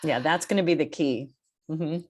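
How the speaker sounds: normal talking voice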